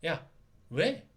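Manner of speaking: high, needling teasing voice; mocking taunt. Knight Gundam taunting the demon lord, mocking high taunt. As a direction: poke at him to provoke. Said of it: "도발을 하는 거예요".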